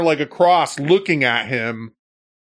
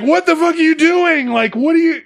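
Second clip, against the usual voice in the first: high-pitched, louder exasperated demand; incredulous yell; 4 dB louder than usual. Retold or imitated. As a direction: shout it at him in disbelief. Retelling yelling at friend.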